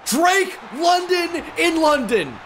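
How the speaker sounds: high, drawn-out announcer's cry; excited call-out. Real, not acted. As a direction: call it out high and stretch each word. Calling out a touchdown play.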